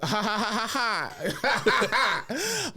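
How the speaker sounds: high, deliberate "ha ha ha"; stagey villain's laugh rising in pitch; mock gloating laugh. Dark-skinned boy watching cousins burn, mock gloating laugh.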